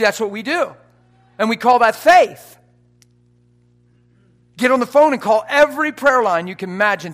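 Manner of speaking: loud, high, exultant proclamation; full-force preaching. Real, not acted. Preacher retelling his triumph leaving the hospital, full-force preaching. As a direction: shout the victory over the lying spirit.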